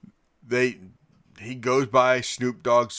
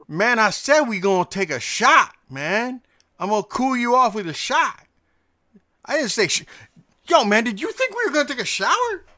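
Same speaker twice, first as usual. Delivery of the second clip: Snoop impression; 12 semitones higher, 4 dB louder than usual. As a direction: do Snoop laughing at the mix-up, pitched up. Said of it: "you know how when [name] talks".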